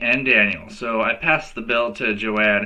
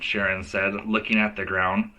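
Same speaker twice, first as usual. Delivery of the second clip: plain reading voice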